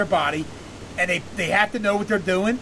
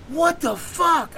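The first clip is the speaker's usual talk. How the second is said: slow, higher, drawn-out disbelief; retold panic; 6 semitones higher, much slower than usual. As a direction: stretch it out in mock distress.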